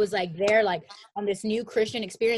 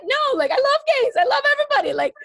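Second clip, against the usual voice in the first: much higher, louder squeaky protest; high defensive self; 10 dB louder, faster than usual. Retold or imitated. She protests to her teasing brother, high squeaky and louder. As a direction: squeal it in shocked denial. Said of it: "it'd be like".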